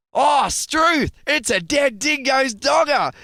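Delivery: hammed-up broad Australian, high and loud; broad Australian accent. Host faking Aussie for a foreigner, broad Australian accent. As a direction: overdo the Australian ocker voice. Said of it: "do an Australian accent".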